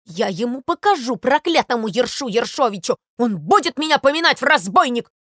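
loud, emphatic acted rage; indignant, worked-up shouting; furious yelling. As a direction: rant and threaten as an outraged sparrow. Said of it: "орал Воробей Воробеич, задыхаясь от ярости".

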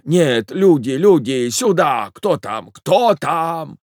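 flustered calls, building at the end; old man shouting. A narrator's reading. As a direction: call out in alarm like an old husband. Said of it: "закричал старик".

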